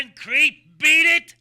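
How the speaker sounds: high, loud shout; shouted brush-off. Acted. Luigi throws the old man out, shouting. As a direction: bark him out the door.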